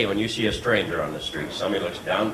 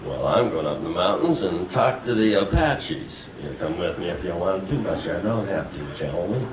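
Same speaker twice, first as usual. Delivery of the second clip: John Wayne; 5 semitones lower, 3 dB quieter than usual. Shown as a demonstration. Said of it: "the late, great John Wayne".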